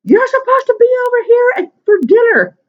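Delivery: high, loud, mock-irritated yell down the phone; irritated yelling mom. Mother scolding her late daughter on the phone, loud irritated yell. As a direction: play the half-deaf mother scolding down the line. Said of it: "she's a little irritated".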